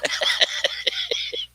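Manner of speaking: high, wild cartoon woodpecker cackle; Woody Woodpecker laugh. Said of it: "That was very bad Woody Woodpecker impression".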